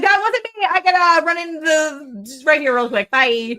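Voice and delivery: high, loud play-acted excuse and getaway; hammed-up exit line with a loud goodbye; mock hasty excuse. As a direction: blurt it high and loud, acting out a quick escape. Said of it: "She's like".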